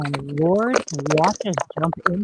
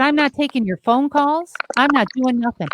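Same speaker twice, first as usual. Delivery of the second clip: raised, louder ultimatum; retold firm yell; 6 semitones higher, 3 dB louder than usual. Therapist shouting her ultimatum at the client. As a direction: lay down the law loudly. Telling addict client to leave.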